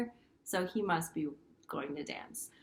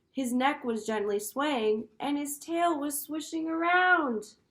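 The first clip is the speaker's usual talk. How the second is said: louder, joyful read-aloud; lively storybook reading; 3 semitones higher, 5 dB louder than usual. Acted. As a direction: share the happy turn with the kids. Teacher reads picture book aloud.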